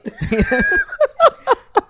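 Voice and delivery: long loud laugh, high. Real, not acted. About to explain his Hanscom grudge, long high laugh. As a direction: laugh helplessly for a moment.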